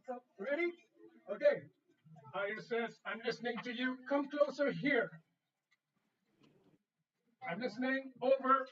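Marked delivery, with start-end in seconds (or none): 2.3-8.6 loud, clear pretend radio talk; loud astronaut radio demo. Shown as a demonstration. Teacher pretending to be the astronaut at rehearsal, loud. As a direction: play the astronaut answering over the radio. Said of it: "vi låtsas nu att vi är en astronaut".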